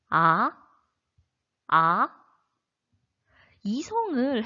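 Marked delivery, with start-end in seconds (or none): 0.1-0.5 second tone. Shown as a demonstration. Teacher demonstrating Mandarin second tone, rising "아". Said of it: "점점 일성의 소리로 올라가야 합니다". 1.7-2.1 "아" lifting steeply from a low start; second tone. Shown as a demonstration. Teacher repeating the second-tone drill, rising "아". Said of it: "아로 한번 연습해 볼게요".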